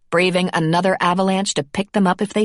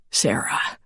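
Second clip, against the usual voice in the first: breathy whispered name; 4 dB quieter than usual. A narrator's reading. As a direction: whisper her name softly. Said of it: "he whispered".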